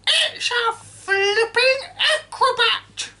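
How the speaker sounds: put-on high, loud screeching old woman; cranky grandma yelling from the roof; shouting grandma. A narrator's reading. Grandma voiced throughout.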